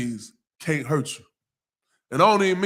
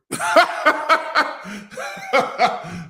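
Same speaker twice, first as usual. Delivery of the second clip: burst of laughter; 5 semitones higher than usual. Real, not acted. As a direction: laugh hard at the absurd advice. Pastor cracks up at the story.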